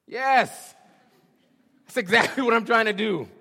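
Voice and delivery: high, loud triumphant yes; preacher booming Paul's answer to the king; bold shout. Acted. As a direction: shout it out with conviction.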